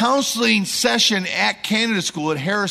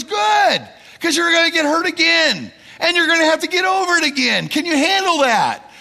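loud, much higher counselor bark; blunt shout; 11 semitones higher, 5 dB louder than usual. Retold or imitated. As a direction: hammer it home, loud and high. Counselor bluntly warns pastor.

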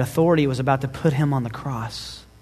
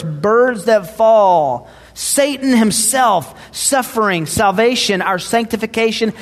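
louder, higher preaching run building through the list; rising preaching fervor; 5 semitones higher, 5 dB louder than usual. Acted. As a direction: pile each item on with mounting conviction. Builds a list toward God's control.

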